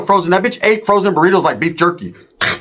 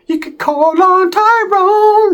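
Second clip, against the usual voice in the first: mock high voice; 14 semitones higher, much slower than usual. Storyteller mocking Sharky on the phone, high and slow. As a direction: stretch it out high like a silly mockery.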